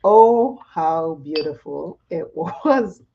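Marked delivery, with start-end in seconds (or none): 2.1-3.0 laughing through the words. Real, not acted. Talking to viewers while laughing. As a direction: giggle out the word beautiful. Laughing over beautiful makeup look.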